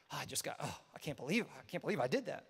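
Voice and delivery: low, soft, stunned regret; quietly aghast at what you just did; dejected regret. Acted. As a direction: mutter it low, ashamed at yourself. Acting regret just after sinning.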